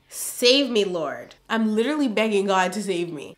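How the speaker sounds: ordinary talk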